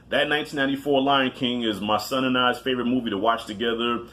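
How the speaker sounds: ordinary delivery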